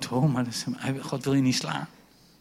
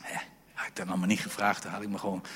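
quick whispered aside; 6 semitones lower, 6 dB quieter, much faster than usual. Real, not acted. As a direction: hush it for the babies. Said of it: "Even niet schrikken". (gefluisterde opmerking)

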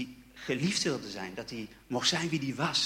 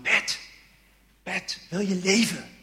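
higher, louder call of an inner voice; 3 semitones higher, 5 dB louder, slower than usual. Retold or imitated. Inner voice at a party calling him by name, raised call. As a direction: voice from within asks gently but clearly.

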